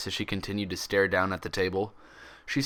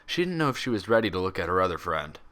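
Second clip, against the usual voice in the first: ordinary delivery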